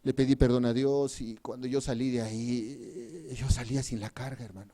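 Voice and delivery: low, soft and subdued; hushed testimony. Real, not acted. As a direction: drop right down for an intimate confession. Testimony of relief after repentance.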